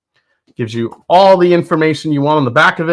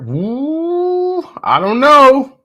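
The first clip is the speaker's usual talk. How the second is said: delighted ooh; 12 semitones higher, much slower than usual. Real, not acted. Tasting whiskey, delighted reaction.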